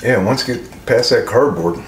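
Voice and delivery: eating between words; talking while chewing. Real, not acted. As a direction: mouth partly full of food. Seen 0: Bit slice at -1.0; holds pizza, head down, mouth likely full.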